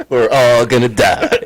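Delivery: slow, laughing through the words; giggling doom line. Real, not acted. Laughing echo of joke.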